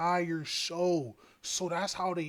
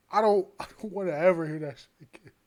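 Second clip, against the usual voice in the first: usual speaking voice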